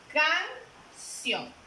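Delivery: "canción" dividida en «can» y «ción», con pausa clara; sílaba por sílaba. Shown as a demonstration. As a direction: separa "canción" en dos golpes de voz: can... ción. Teacher splits word into syllables. ("canción" silabeada, con una pausa entre «can» y «ción»)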